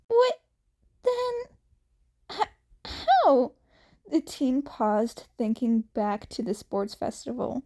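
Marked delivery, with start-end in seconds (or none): 0.1-3.5 put-on high, flustered boy; baffled question; confused. A narrator's reading. Izuku confused.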